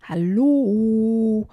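long, loud call across water. Acted. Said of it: "ruft Emily über das Wasser".

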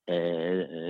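ordinary talk

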